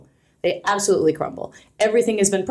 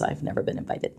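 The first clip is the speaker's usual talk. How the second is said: a breathy whispered aside, almost to herself; 10 dB quieter than usual. Real, not acted. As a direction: drop to a conspiratorial whisper. Wry aside about the washroom.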